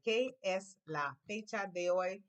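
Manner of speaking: slow, deliberate "¿Qué es la fecha de hoy?" for repetition; slow model phrase. Shown as a demonstration. Teacher slows the question down for students to repeat. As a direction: spell it out slowly so the class can copy. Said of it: "Repeat, clase".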